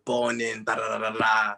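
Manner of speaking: ordinary talk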